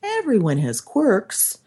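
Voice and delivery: high-pitched talking yourself down; lightly acted soothing inner voice; self-reassurance.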